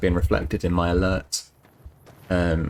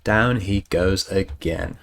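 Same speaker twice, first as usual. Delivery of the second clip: slow drawn out; much slower than usual. Acted. Slow stretched repeat.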